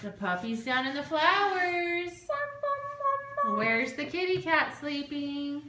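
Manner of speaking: put-on high sing-song voice for a small child; reading to a toddler. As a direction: point things out to a little one. Mother talks to toddler about scene.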